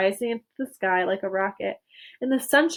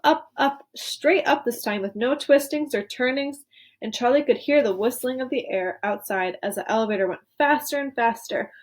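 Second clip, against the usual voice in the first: ordinary delivery, nothing marked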